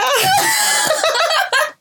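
very high, wailing burst of laughter; high laughing shriek. Real, not acted. Laughing at her own tearful retelling.

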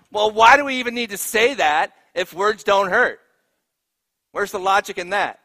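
loud, high, indignant questioning; exasperated challenge. Real, not acted. Preacher asking why we teach that rhyme, exasperated.

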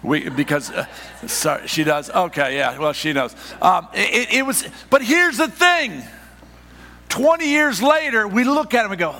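high, loud, driving sermon delivery; full-force preaching. Acted. Pastor to congregation, preaching at full force.

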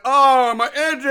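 loud, drawn-out mock lament; exaggerated cry of car trouble; mock dismay. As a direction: wail it like a hammy stranded driver. Acts out stranded driver's complaint.

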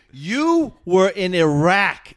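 retold exasperated lecture; incredulous scolding. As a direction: hammer each point in disbelief. Retold scolding of panicked friend.

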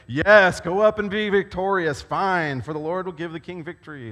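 loud, high mock agreement; sarcastic prophet mocking the king's wish, loud and high. A narrator's reading. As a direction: scoff at the king with exaggerated yes-saying. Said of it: "Micaiah replied sarcastically".

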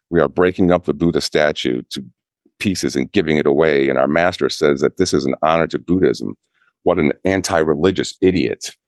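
ordinary talk, nothing marked

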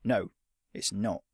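put-on terse old fighter; short. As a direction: shut the question down. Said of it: "he said shortly".

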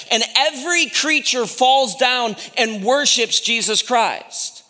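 high, driving sermon delivery; impassioned preaching. Real, not acted. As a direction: preach it with full, rising conviction. Preaches on the worship in heaven.

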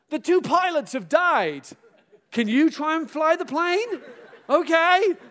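high, loud dramatic plea, slow; film crisis voice. Pretend. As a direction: play the panicked movie announcement. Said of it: "in those films".

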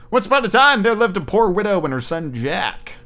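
lively read-aloud storytelling voice; fairy tale narration; storybook reading. Acted.

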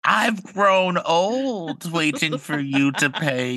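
high, slow crone lament; old witch voice. Pretend. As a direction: moan it like an ancient witch. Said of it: "pretend like I'm the old witch".

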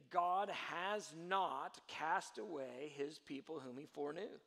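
slow, weighted reading; slow scripture quote. Shown as a demonstration. Preacher quoting Romans, slow and weighty. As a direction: read the verse slowly. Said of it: "he'd say".

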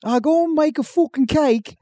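high, swearing hammed-up Cockney; mock Cockney accent. Cohost parodying a British bake-off contestant, mock Cockney accent. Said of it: "Cockney accent".

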